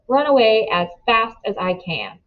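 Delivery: ordinary delivery, nothing marked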